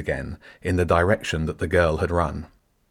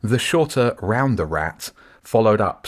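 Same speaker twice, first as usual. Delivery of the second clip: usual speaking voice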